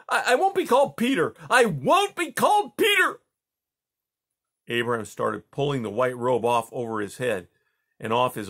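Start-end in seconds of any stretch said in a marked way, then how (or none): 0.1-3.2 very high, loud raging shout; furious shout. A narrator's reading. Boy refusing the Tsar's name, furious shout. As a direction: scream your refusal. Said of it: "panting and clumsy with rage".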